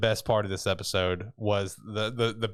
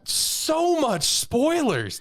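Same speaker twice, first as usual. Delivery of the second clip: very high, slow, drawn-out wail; overwhelmed by spoilers; stretched-out outraged cry; exasperated excitement; 5 dB louder, much slower than usual. Real, not acted. Reacting to preview spoilers.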